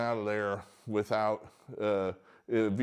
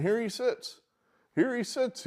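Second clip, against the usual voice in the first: amazed emphasis; 12 semitones higher than usual. Real, not acted. Emphatic repeat.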